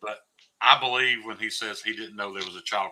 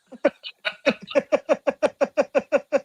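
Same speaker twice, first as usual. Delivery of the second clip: long laugh pitched way up; high cackling laugh; 4 dB louder than usual. Real, not acted. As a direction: burst into a high, wheezing cackle. Cracks up at own joke.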